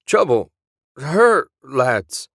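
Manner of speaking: put-on high, loud broken shouts in Willie's accent; Willie's garbled warning. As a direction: bark it out in Willie's accent like a failing radio. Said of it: "Play that last sentence again".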